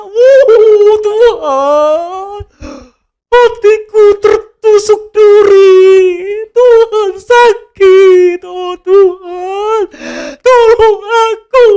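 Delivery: high, loud, very slow hammed-up sobbing; mock sobbing prayer. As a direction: parody a melodramatic crybaby. (doa meratap berlebihan sambil pura-pura menangis)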